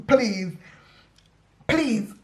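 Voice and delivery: normal talking voice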